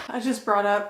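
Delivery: usual speaking voice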